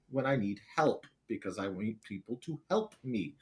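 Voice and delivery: ordinary talk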